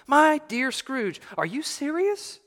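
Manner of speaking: high-pitched, amazed question; astonished, pitch soaring upward; incredulous gentleman. A narrator's reading. Gentleman amazed by Scrooge's offer.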